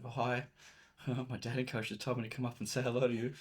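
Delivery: imitating a shy, giggly hello; a hammed-up nervous boy greeting a girl; mock nervous. Client approaching a girl, mock nervous and giggly. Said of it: "they do it really nervously".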